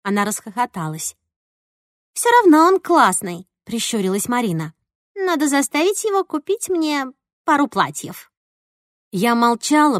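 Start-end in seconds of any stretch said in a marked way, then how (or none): 2.1-3.5 bright, lifted and loud; excited. Acted. Marina's excited quote. 5.1-8.3 put-on higher, lilting girlfriend voice; playful girl voice. Marina's scheming quote.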